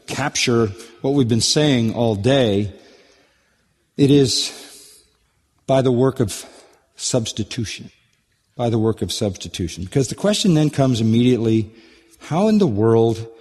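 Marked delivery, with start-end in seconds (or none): none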